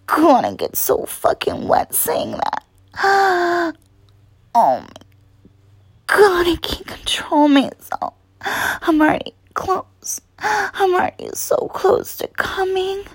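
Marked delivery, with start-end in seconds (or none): 3.0-3.7 sharp needy gasp; breathy gasp. Pretend. Lover overcome mid-plea, gasping. Gasp within erotic roleplay. 4.6-13.0 higher, whimpering urgency; breathy plea, as if losing control; performed desperation. Pretend. Lover confessing lost control to a partner, desperate and whimpering. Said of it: "do you hear the desperation in my voice".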